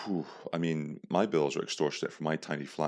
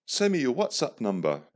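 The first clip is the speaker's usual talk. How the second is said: higher-pitched mock-London trader; attempted London accent; 6 semitones higher, 4 dB louder than usual. London trader goes straight for the kill, in a put-on London accent. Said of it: "I can't do a London accent, but I'll try".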